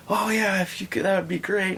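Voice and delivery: high, pleading imitation of the stranger; distraught man. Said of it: "like, distraught".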